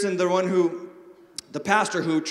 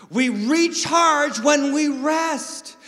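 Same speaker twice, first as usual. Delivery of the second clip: slow, deliberate and louder; slow emphatic line; 6 semitones higher, 5 dB louder, much slower than usual. Real, not acted. Preacher driving home rest, slow and emphatic. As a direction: lean on each word slowly. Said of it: "sermon emphasis".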